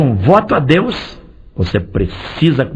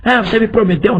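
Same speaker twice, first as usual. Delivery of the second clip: mock accusing voice; 10 semitones higher than usual. Mock God demanding a vow.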